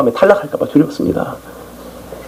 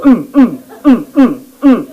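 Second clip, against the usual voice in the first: high, short pleading groans; groaning prayer; 6 semitones higher, slower than usual. Shown as a demonstration. (높은 음으로 짧게 신음하듯 기도)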